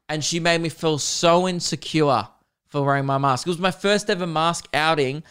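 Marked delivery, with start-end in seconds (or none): none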